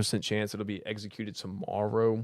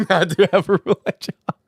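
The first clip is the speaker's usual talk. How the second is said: words bubbling out through laughter, higher; laughing; 8 semitones higher, 4 dB louder than usual. Real, not acted. Streamer answering chat about a day job, laughing. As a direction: say it while cracking up.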